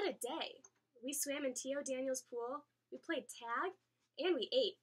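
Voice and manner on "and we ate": plain reading voice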